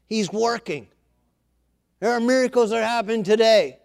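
loud, high, full-force sermon delivery; impassioned preaching. Real, not acted. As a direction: preach it out to the whole congregation, building in force.

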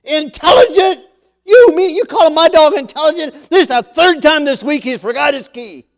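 high, very loud outraged rant; acted exasperated dog owner yelling; angry shouting. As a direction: shout back in disbelief. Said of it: "an angry man starts shouting at the dog".

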